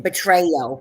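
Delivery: "betrayal" in a thick Boston accent. Shown as a demonstration. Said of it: "In my, in my Boston accent".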